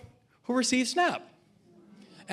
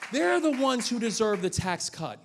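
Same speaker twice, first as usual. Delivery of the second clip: ordinary delivery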